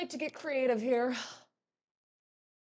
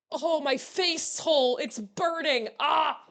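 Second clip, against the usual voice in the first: higher, louder, drawn-out hammy cry; mock agony; 4 semitones higher, 3 dB louder, slower than usual. Streamer acting out a burning face hole, mock agony. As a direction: wail in pretend pain.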